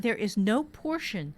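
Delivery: ordinary delivery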